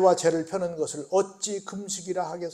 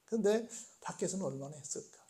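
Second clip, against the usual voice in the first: whispered teasing question; hushed wondering aside; 10 dB quieter than usual. Real, not acted. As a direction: lean in and whisper it to them.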